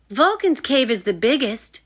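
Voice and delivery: high, loud boast; bragging. Acted. Rory boasts to the new boy, high and loud. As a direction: brag like a proud kid. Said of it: "bragged Rory".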